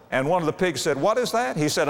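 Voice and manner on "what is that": curious pig. Acted. Pig asks the son.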